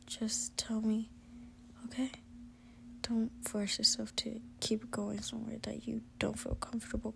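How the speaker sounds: put-on whispered reassurance to a frightened girlfriend. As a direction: coax her to speak, gently whispering. Boyfriend cuddling and reassuring listener.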